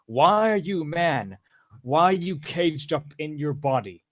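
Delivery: hammed-up exasperated fan; mock outcry. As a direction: wail it in mock dismay, imitating the girls. Quoting girls' exasperated reaction to him.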